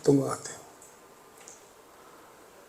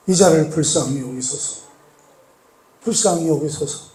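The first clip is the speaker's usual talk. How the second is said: pleading prayer; 7 dB quieter than usual. Acted. Acted plea in prayer.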